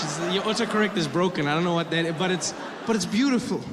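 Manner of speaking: normal talking voice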